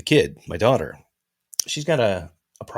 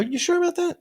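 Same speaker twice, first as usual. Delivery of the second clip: much higher mock squeak; playful high-pitched jab; squeaky teasing. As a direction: tease him in a high squeal. Teasing co-host.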